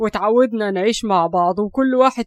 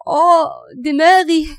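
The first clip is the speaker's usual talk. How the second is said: slow, higher, louder pained cry; pained groan; 7 semitones higher, 4 dB louder, slower than usual. Acted. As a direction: moan as her head throbs. Head hurts badly. (تأوه من وجع الدماغ)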